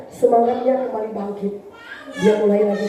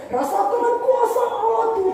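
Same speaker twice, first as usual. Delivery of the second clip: much higher, exuberant shout of praise; excited praise cry; 11 semitones higher than usual. Retold or imitated. Preacher imitating the young man praising God, exuberant. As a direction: burst out like a youth lost in worship. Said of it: "dia langsung".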